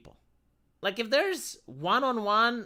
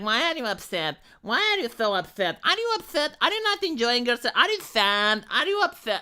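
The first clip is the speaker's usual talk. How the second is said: mock pestering partygoers; 5 semitones higher, 3 dB louder than usual. Imitating party guests pestering him.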